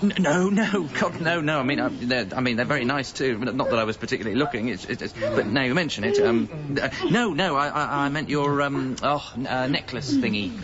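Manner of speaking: high, stammering flustered denial; flustered panic. Acted. Michael panics after Kate thinks he meant her breasts, stammering high. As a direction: scramble to take it back.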